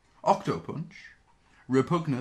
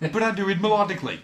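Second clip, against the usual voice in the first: louder, fast, laughing; laughing reply; 9 semitones higher, 8 dB louder, faster than usual. Pretend. Host replying as Sixshot while laughing, loud and fast. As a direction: laugh through the comeback.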